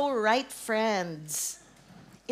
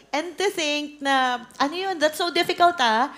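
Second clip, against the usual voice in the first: louder, higher exasperated complaint; raised voice, exasperated; 4 semitones higher, 7 dB louder than usual. Real, not acted. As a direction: lament how hard it was. Complaining about the failed chase.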